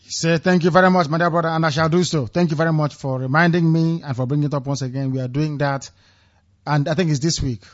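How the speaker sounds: ordinary delivery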